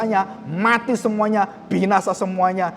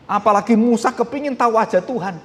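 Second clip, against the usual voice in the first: ordinary talk, nothing marked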